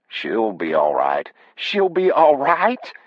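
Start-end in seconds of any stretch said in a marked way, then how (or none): 1.5-2.9 high, drawn-out disbelieving question; slow, high-pitched incredulous wife. Acted. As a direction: echo it back in a high voice, stretched and rising. Wife throws his words back.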